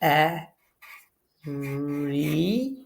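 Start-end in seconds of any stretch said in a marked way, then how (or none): 1.4-2.8 isolated sound Re, soft and clipped short; phonics sound. Shown as a demonstration. Teacher isolating a sound for pupils, soft and separate. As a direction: sound out Re alone for the children. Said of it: "Very begins with a V".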